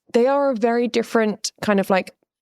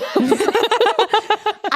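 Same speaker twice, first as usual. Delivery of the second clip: high burst of real laughter; laughing at her own joke; 14 semitones higher than usual. Real, not acted. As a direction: break up laughing.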